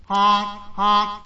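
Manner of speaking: high honks of a goose; goose honking. Acted. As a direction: honk like an angry goose. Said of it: "said the goose".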